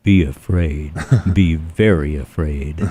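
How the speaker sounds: low, slow, ominous doom voice; phantom voice of doom. Shown as a demonstration. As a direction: let the dread sink in. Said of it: "The phantom voice of doom?".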